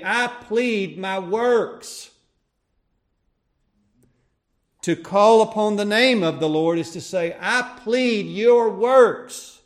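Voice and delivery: high, loud, building preacher's cry; fervent preaching. Acted. Preacher rising to full force before the church. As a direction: preach it out to the congregation.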